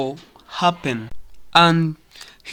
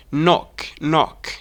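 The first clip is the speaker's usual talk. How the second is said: British "knock" with rounded O; British pronunciation. Shown as a demonstration. Teacher contrasting for learners, British "knock". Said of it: "but in British is".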